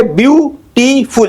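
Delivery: Beautiful slowly in three beats, BYU, TEE, FUL; split into syllables. Shown as a demonstration. Teacher breaking down the word for students, slowly split up. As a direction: separate and stretch each syllable of beautiful. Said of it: "इसमें तीन है".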